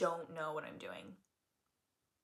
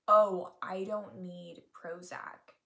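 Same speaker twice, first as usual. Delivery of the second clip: slow realization; 7 dB louder, much slower than usual. Retold or imitated. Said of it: "I realized".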